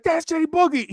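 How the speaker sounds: high-pitched imitation of an excited child; starstruck kid. Imitating a little kid spotting [name], starstruck cry. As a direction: squeal it like a kid spotting an idol. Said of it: "He started crying tears".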